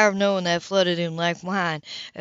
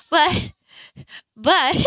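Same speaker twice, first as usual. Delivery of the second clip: higher, louder stammered protest; flustered protest; 9 semitones higher, 6 dB louder than usual. Acted. Heroine losing her train of thought, higher stammered protest. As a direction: stumble over the words, losing focus.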